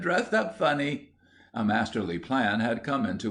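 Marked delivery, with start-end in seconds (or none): 0.0-1.1 put-on high childish jeer; derision. A narrator's reading. Small boy mocks William's costume, derisive. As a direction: tease him in a squealing pitch. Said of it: "gave a shout of derision".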